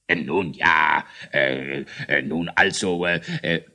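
low, hesitant king voice; doubtful hemming. Acted. King Eierbatz doubting the ghost story, low and hesitant. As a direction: hem and haw uncertainly. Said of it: "meinte der König und machte ein zweifelndes Gesicht".

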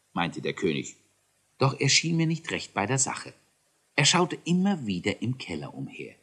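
usual speaking voice

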